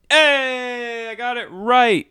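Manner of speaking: high, bright cheer of a correct guess; excited cheer. Real, not acted. Player cheering his correct verdict, excited.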